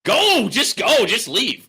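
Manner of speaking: slow, high, loud dismissal; hollering. Real, not acted. Streamer telling a complaining viewer to leave, hollering. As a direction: holler each word to send them off. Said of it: "You don't like the hollering".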